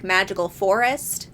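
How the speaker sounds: ordinary delivery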